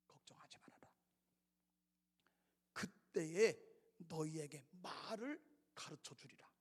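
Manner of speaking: soft, unvoiced comfort; whispered reassurance. Jesus whispering comfort to his worried disciples.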